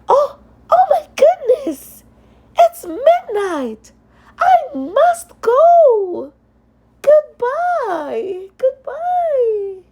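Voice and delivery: put-on high, loud, frantic girl's cry; panicked Cinderella. Cinderella cries goodbye to the prince at midnight, high and frantic. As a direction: flee in a panic.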